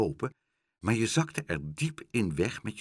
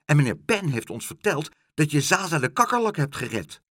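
put-on excited kids; 5 semitones higher, 6 dB louder than usual. A narrator's reading. Boys voiced.

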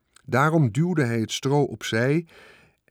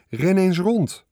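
high, loud order to run; commanding; 9 semitones higher, 6 dB louder, slower than usual. A narrator's reading. Charlotte orders the pig Wilbur to run, commanding. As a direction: bark the order like a trainer. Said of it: "beval Charlotte".

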